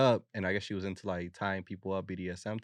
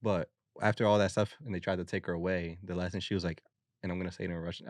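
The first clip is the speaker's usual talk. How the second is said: ordinary talk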